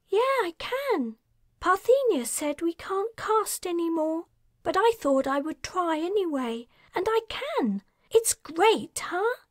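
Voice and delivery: put-on young man Darius, cheerful. A narrator's reading. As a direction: greet her brightly.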